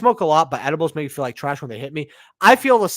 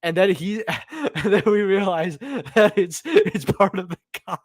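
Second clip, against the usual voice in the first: laughing; 3 semitones higher than usual. Real, not acted. Laughing at his own mistake.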